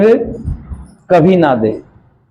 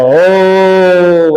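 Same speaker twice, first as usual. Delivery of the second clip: a jackal's half-hearted howl; mild mock yell of the jackal; mock loud call; 4 semitones higher than usual. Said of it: "बड़ी जोर जोर से बोल रहा".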